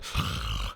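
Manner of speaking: harsh exhaled breath; low seething exhale. Shown as a demonstration. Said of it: "seething at the mouth".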